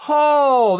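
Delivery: loud, high drawn-out "oh"; shocked cry. Real, not acted. Host reeling at the chopped card, shocked howl. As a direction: howl in disbelief.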